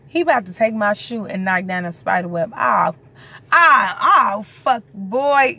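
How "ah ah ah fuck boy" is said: loud, higher yelps of fright; spooked squeals, louder; startled yelp. Real, not acted. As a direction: jump at the spider web and cry out. Spider web knocked near her.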